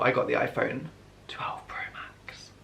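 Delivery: secretive whisper; whispered reveal. Shown as a demonstration. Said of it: "Drum roll, please".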